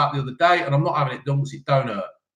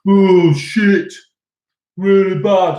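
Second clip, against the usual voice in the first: louder, sulky mock answer; mock teen reply; 3 semitones higher, 6 dB louder, much slower than usual. Father mimics daughter's careless exam answer, louder. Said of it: "And it's".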